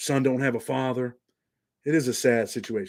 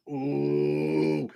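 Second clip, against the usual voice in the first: sustained, level ooh; long drawn-out "ooh". Real, not acted. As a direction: hold one long cooing ooh. Reacting to a name he read.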